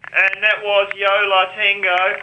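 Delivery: distant off-mic DJ voice; slow, high, far from the mic. Retold or imitated. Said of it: "would be way off mic going".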